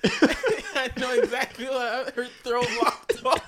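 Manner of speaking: high, loud words squeezed through laughter; real laughing fit, slow and high-pitched; helpless laughter. Real, not acted. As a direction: talk while cracking up. Everyone cracking up at the mishap.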